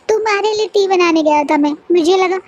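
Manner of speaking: high tearful whine, words breaking; a wounded boy crying while he complains. Acted. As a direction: sob it out like a hurt child. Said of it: "रोते बोलता है".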